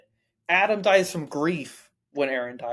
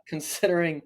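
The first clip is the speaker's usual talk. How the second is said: ordinary talk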